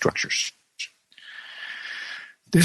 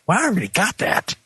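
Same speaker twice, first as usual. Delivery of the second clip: high, put-on voice of mild impatience; irritated high aside; impatient inner remark; 9 semitones higher than usual. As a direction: play a reader who already got the point. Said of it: "and I think".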